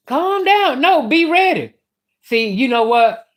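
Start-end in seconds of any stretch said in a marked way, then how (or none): 0.1-0.8 calm down thrown high; mock him high, pitched up; higher mock calm down. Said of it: "Every time he said". 0.8-1.7 be ready pushed up high; higher insistent repeat. Retold or imitated. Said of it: "I said".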